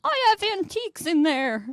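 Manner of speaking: high-pitched, mock-distressed old man; lightly voiced old man. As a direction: imitate an old passenger fretting over valuables. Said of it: "some other old man on the bus is like".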